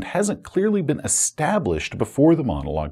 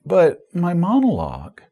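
higher, plaintive protest; mock dismay; 5 semitones higher than usual. Game master loses his monologue to players, mock-plaintive.

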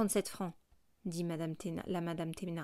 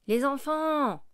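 loud, higher cry of delight; exclaiming; 7 semitones higher, 7 dB louder, much slower than usual. A narrator's reading. Thénardier crying out fondly at the three girls. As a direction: call out to the children. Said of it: "s'écria la mère Thénardier".

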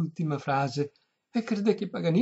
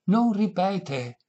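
louder, slow and higher; raised voice; 4 semitones higher, 5 dB louder, much slower than usual. A narrator's reading. Violinist repeating himself to deaf lady, raised slow voice. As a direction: spell it out louder and slower. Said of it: "a voce più alta".

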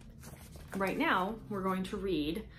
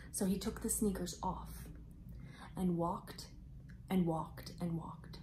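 hushed storytelling; 7 dB quieter, slower than usual. A narrator's reading. Mouse walks on sore feet.

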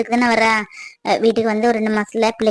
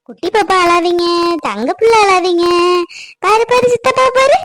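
high sing-song coo rising higher; coaxing baby talk; 4 semitones higher than usual. Acted. Telling the baby to look at uncle, high coaxing.

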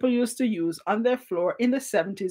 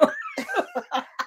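very high, softer giggling laugh; real laughter; 6 dB quieter than usual. Real, not acted. Host amused by a slow typist's plea: high laughter.

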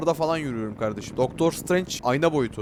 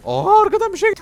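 high, loud startled shriek; 11 dB louder than usual. Real, not acted. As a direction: yelp as something jumps out behind you. Startled by something behind. (arkadan gelen şeye korkuyla çığlık)

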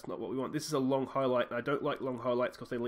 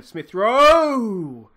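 loud, high call of the name; excited shout; 9 semitones higher, 16 dB louder than usual. Real, not acted. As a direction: yell it as he shoots. Shot on goal, calling out.

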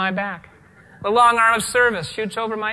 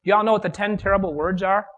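usual speaking voice

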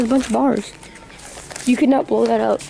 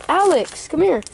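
calling out; 7 semitones higher, slower than usual. Real, not acted. Calling friend across store.

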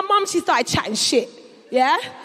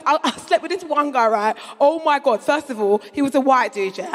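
normal talking voice

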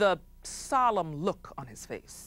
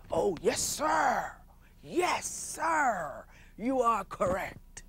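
fawning courtiers; 4 semitones higher, 4 dB quieter, much slower than usual. Acted. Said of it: "all the men around would say".

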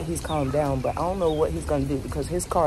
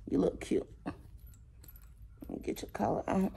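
whispering; 3 semitones higher, 12 dB quieter than usual. Real, not acted. Whispering softly to dog.

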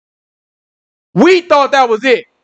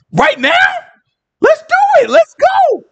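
very high, eager cheer; rally the crowd to go; excited rallying; slower than usual. Acted. Israelites rallying around Moses to get out, excited.

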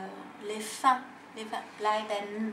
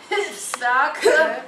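laughing; 4 semitones higher, 10 dB louder than usual. Real, not acted. Laughs struggling with word.

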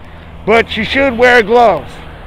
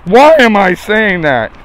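ordinary talk, nothing marked

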